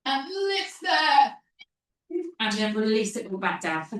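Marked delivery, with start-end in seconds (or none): 0.1-1.4 drawn-out call. Real, not acted. Coach cueing a lift.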